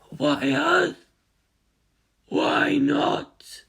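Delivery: high wailing cries fading to a whisper; crying hive mind. Acted. Collective mind crying out questions, high wails then whispers. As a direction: cry out each why, then breathe the last.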